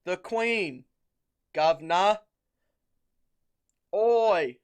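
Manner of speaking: loud, slow hammed-up cockney Brit; mock British accent. British soldier in the skit demanding tea, loud mock British accent. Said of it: "I'll set the scene".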